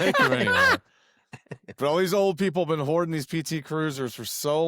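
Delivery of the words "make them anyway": squeaky laugh through words; high-pitched giggling line; laughing squeal. Real, not acted.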